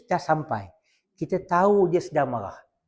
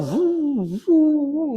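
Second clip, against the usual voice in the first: steam puff; 9 semitones higher than usual. Retold or imitated. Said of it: "dia asap keluar".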